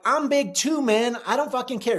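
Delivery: high, heated comeback. Retold or imitated. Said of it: "my voice is very raised".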